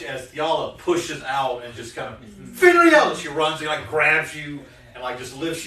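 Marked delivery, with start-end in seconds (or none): 2.6-3.1 high, loud cry of a name; overjoyed shout of a friend's name; joyful cry. Acted. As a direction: call out in sheer delight. Fiala spots her friend, cries out.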